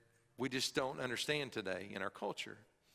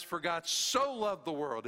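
"so" drawn out and weighted, the rest slower; stress on so; 4 semitones higher, 5 dB louder than usual. Shown as a demonstration. Preacher quoting the verse again, stress on "so". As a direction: press on "so" to show how much. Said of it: "It's the extent of God's love".

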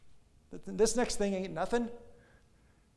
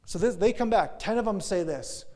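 raised, louder storytelling; emphatic; 4 semitones higher, 7 dB louder than usual. A narrator's reading. Pastor retelling the spies' return, raised and emphatic. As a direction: lift the voice to grab the room.

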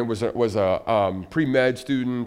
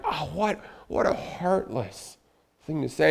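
aghast; 5 semitones higher than usual. Retold or imitated. Friends react to heartless remark.

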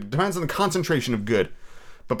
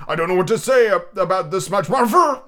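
loud, raised, stretched-out amazement; overwhelmed gentleman; 6 semitones higher, 5 dB louder than usual. Acted. Gentleman thanking Scrooge for a huge gift, overwhelmed. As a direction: falter grandly over the words.

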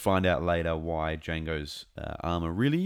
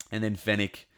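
ordinary talk

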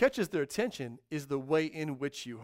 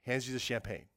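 normal talking voice

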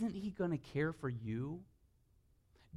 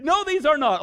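much higher and louder mock refusal; mock stern dad shout; 14 semitones higher, 15 dB louder than usual. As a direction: bark a hammed-up no.

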